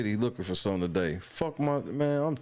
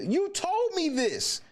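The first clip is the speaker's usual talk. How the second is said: high-pitched squeak; slower than usual. Acted.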